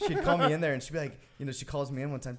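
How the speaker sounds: ordinary talk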